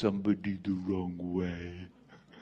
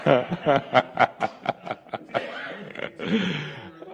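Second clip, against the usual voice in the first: breathy laugh; 4 semitones higher than usual. Real, not acted. Laughing at own joke.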